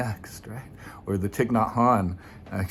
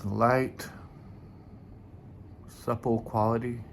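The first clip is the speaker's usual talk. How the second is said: guided meditation; 5 dB quieter than usual. Acted. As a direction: ease listeners gently into the breath. Leads a meditation.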